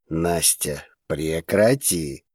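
low, slow, strained voice. Acted. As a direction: force it out, held back. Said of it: "сдавленно произнёс мужчина".

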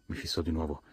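ordinary delivery

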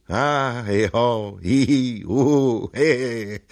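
loud, high string of open vowels; knife-grinder's wordless exultation; exultant vowel cries. A narrator's reading. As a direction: call out each vowel at high pitch, elated.

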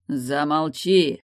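slow, drawn-out order from a strict father; lightly voiced stern man; stern command. A narrator's reading. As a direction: silence someone with measured authority. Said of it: "донёсся строгий мужской голос".

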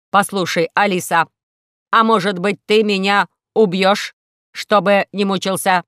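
loud, measured robot plea; mournful robot. Acted. Robot begs to be killed.

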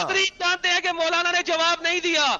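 high, slow, sustained call; taunting proclamation. Real, not acted. Debater to viewers over the cleric, taunting proclamation.

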